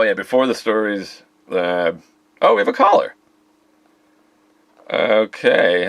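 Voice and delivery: upbeat call-in show host; radio host. Pretend. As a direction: welcome listeners back like a DJ. Radio host back from break.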